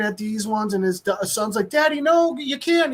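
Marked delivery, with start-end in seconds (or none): 1.7-2.9 high kid's plea, lightly voiced; pleading child. Son begs his dad not to shut him out: high childlike plea. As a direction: beg like a small child.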